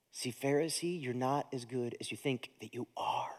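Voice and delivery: hushed whisper, almost no voice. Real, not acted. Pastor confronts the Pharisee with a hushed whisper. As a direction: lean in and breathe it out like a secret.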